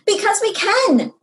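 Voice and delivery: put-on a child blurting back at grandpa; almost shouting. A narrator's reading. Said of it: "I'd almost shouted".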